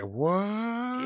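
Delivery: high, drawn-out mock "what"; acted-out astonishment. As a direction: stretch it out in exaggerated disbelief. Acted astonishment at Korean woman.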